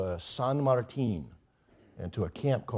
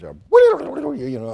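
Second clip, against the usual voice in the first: much higher, louder burst of friendly Spanish chatter; imitating Juan; 12 dB louder than usual. Juan greeting the guest in Spanish, hearty high burst. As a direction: mimic an eager host rattling off Spanish. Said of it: "starts talking to me in Spanish".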